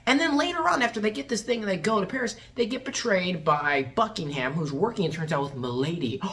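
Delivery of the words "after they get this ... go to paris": ordinary talk, nothing marked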